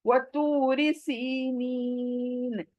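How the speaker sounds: slow, beautified Quranic recitation; melodic recitation. Shown as a demonstration.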